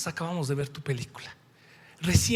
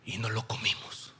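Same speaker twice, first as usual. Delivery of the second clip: whispered aside, much softer; 10 semitones lower, 8 dB quieter than usual. Real, not acted. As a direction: drop to a whisper. Aparte confidencial en testimonio.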